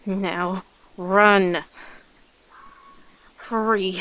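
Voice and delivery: loud commanding shout; urgent warning. Acted. Predator ordering the human to flee, loud and urgent. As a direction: burst out, driving them away.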